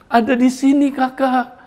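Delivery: put-on the boy weeps as he finds his lost shoes; a child's tearful joy, high-pitched; crying with joy. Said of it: "dia menangis".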